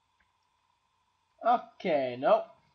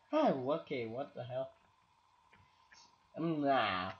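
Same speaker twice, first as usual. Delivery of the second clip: normal talking voice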